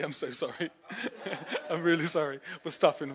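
real giggles breaking through the apology; laughing apology. Real, not acted. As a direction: say sorry while you can't stop laughing. Preacher giggles over gory story.

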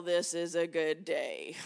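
put-on mumbled, low and dull, like reluctant children; sulky kids. Imitating her small kids' unwilling chant, low and flat. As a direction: drag it out like a sleepy child in the car. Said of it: "imagine my kids when they were little".

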